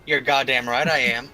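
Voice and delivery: ordinary delivery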